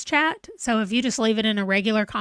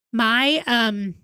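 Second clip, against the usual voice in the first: ordinary delivery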